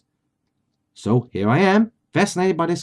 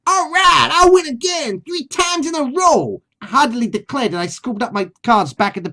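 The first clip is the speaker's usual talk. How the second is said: high squawky Brooklyn parrot voice; a cartoon parrot with a Brooklyn accent; 7 semitones higher than usual. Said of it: "get my best, uh, Brooklyn accent".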